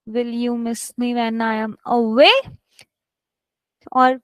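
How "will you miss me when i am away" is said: level, then a rise on "away"; teacher's model with upturned "away"; question intonation. Shown as a demonstration. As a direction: keep it even and lift "away" like a question. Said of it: "it should sound like a question".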